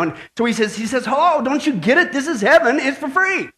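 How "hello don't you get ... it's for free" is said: higher-pitched, exasperated correction; a little exasperated. Acted. Saint Peter to the old man asking about cost, exasperated. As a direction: let mild exasperation push the pitch up. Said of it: "he's starting to get a little exasperated".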